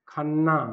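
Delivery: खन्ना with a doubled, held n; खन्ना with a long double n; geminated khanna. Shown as a demonstration. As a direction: stretch the nn in खन्ना. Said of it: "ये जो है द्वित्व होगा".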